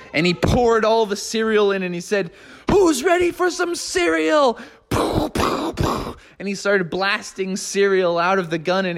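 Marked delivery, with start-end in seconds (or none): 2.7-4.6 much higher, showman call to the crowd; hyped host. Acted. Froggy calling to the neighbors, high excited showman voice. 4.9-6.2 gun blasts. Acted. Imitated t-shirt gun firing.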